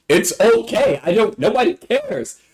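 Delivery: high-pitched, exasperated imagined retort. Retold or imitated. Student imagining stopping the teacher's speech, exasperated. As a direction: cut in with high, fed-up insistence.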